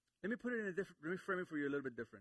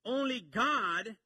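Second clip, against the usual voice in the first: slow and louder, every word landed; emphatic declaration; 8 semitones higher, 8 dB louder, much slower than usual. Acted. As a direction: proclaim it slowly from the pulpit. Sermon climax declaration.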